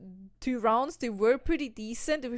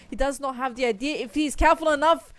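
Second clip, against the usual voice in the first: tense live call; 3 semitones higher, 6 dB louder than usual. Acted. Caster builds tension mid-round.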